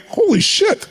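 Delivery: high-pitched mock exclamation of dismay; retold dismay. Man realizing his costly plan is failing, dismayed. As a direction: exclaim it high, reliving the shock.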